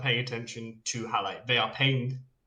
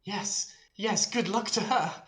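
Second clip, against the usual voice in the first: higher-pitched bystander agreeing; earnest bystander; 8 semitones higher than usual. Acted. Indoctrinated half elf answering the sarcastic remark, higher-pitched agreement. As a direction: agree in a lifted character voice. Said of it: "look at you and go".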